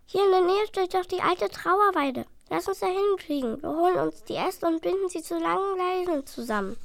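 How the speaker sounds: high, lively plan; eager little dragon. Acted. Dragon proposing a plan to his brother, high. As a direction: pitch the clever idea.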